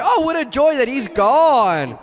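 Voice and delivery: high, drawn-out mock delight; hammed-up rejoicing; imitating joyful founder. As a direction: exult slowly, as if celebrating. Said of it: "And he would say".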